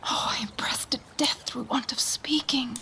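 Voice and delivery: whispered, strained with held-back grief; a choked, secret whisper; anguished whisper. Acted. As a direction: whisper it as if bursting to speak. Queen overhearing in hiding.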